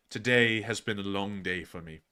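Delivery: normal talking voice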